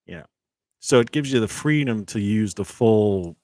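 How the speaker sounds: usual speaking voice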